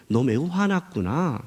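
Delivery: slow, calm, tender observation; gentle. Acted. God answers defiant Jonah, gentle and slow. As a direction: answer defiance soothingly and unhurried. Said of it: "이렇게 대드는데 어떻게 말하는 줄 아세요".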